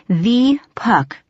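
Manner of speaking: "The" drawn out as "thee"; stress on The. A narrator's reading. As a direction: lean hard and long on "The". Realizing he is the legendary Puck.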